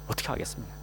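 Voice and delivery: ordinary talk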